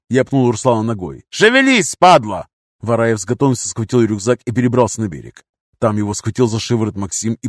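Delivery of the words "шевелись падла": high, loud abuse; angry order. A narrator's reading. Captor kicking captive to hurry, angry shout. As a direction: shout it, dropping onto the insult.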